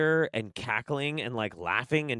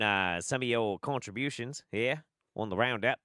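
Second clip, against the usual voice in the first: best-attempt Australian accent, friendly greeting; bad Australian accent. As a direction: greet a mate in put-on Aussie. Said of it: "that's my best, uh, Australian".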